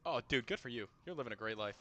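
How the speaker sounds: ordinary talk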